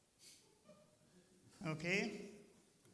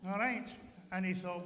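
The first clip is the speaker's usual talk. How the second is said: plain reading voice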